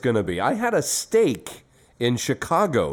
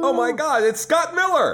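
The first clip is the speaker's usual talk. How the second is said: very high excited squeal; fanboy squeal; 13 semitones higher than usual. Pretend. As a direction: gush like a starstruck fan. Fan excitement at a guest.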